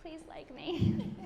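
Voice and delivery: high, slow little plea; mock pleading, drawn out; eager to be liked. Newcomer silently begging new colleagues, hammed-up sweet plea. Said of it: "I'm trying to be like".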